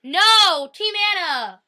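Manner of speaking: high, loud rallying cry; loud, insistent contradiction; shouted protest. Real, not acted. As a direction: yell it like a die-hard fan. Bickering over favourite TV couples.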